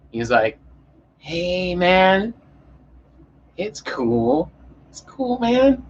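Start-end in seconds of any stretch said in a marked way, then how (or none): 1.2-5.8 high, laid-back stoner cop voice. Retold or imitated. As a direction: imitate a mellow stoner cop, pitched up. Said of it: "it was the most stoner cop I've ever seen".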